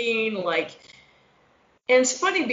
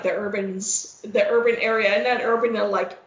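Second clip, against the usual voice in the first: normal talking voice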